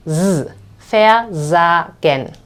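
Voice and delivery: voiced Z carried into the sa of versagen; vibrant S. Shown as a demonstration. Teacher demonstrates vibrant pronunciation, Z buzzed, versagen slow and split. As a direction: buzz Z, then versagen slowly, syllable by syllable. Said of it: "as vibrantly".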